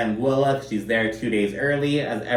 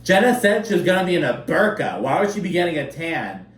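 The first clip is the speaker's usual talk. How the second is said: higher, mildly dopey Sai voice; imitating Sai; 6 semitones higher than usual. Host mimicking Sai insisting about the burqa, higher and dopey. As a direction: play her as dead serious and clueless. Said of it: "She's like".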